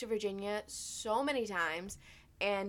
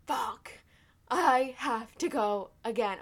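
louder, slow, drawn-out exasperation; retold dismay; 4 semitones higher, 8 dB louder, slower than usual. Back in the car needing to go again, dragged-out dismay. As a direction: stretch each word in mock despair.

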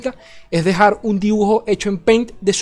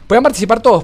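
higher, louder questioning read-out; reading chat question; 4 semitones higher, 6 dB louder, faster than usual. Retold or imitated. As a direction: read the viewer's question with a lift. Reading a viewer's question aloud.

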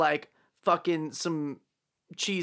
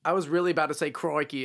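ordinary talk

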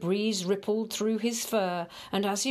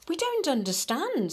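puzzled animal voices; 8 semitones higher than usual. Acted. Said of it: "said the moles and the voles".

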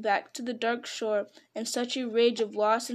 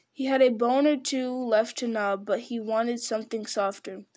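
usual speaking voice

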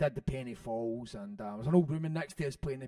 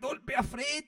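mock shout; 8 semitones higher than usual. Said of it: "You're like".

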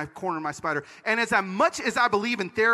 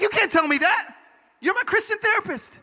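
higher-pitched, incredulous objection; indignant protest; 10 semitones higher than usual. Retold or imitated. Protesting to his therapist in retold talk.

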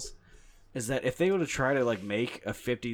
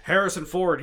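higher, louder Harrison Ford voice; Harrison Ford impression; 7 semitones higher, 8 dB louder than usual. Shown as a demonstration. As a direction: say his name as Ford would. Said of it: "he talks like this".

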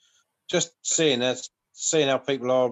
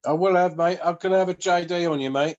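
louder and higher, quick cheery jab; excited banter; 6 semitones higher, 6 dB louder than usual. Real, not acted. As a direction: toast your mate loudly and fast. Joking about drinks on ice.

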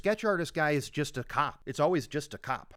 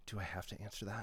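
flirty coy; 6 semitones lower, 14 dB quieter than usual. Retold or imitated. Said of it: "She's, like, flirting with him a little".

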